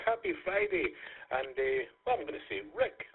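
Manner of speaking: ordinary delivery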